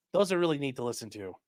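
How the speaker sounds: usual speaking voice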